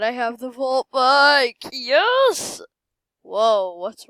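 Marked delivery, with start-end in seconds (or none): 0.0-2.6 loud, climbing to a high cheer; triumphant shout. Real, not acted. Player who just got the vault bike, triumphant shouting. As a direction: burst out loud and end high.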